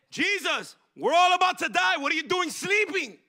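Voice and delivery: high, loud frantic cry; panicked disciples. Retold or imitated. Disciples waking Jesus in the storm, frantic cry. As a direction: scream it like the boat is going down.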